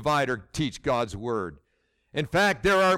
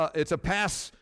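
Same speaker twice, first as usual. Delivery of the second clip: ordinary delivery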